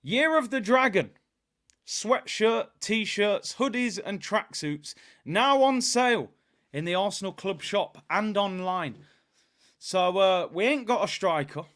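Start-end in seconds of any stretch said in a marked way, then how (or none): 0.0-9.0 stiff mock announcement reading; stiff reading aloud. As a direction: read the ad out stiffly. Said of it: "The email says".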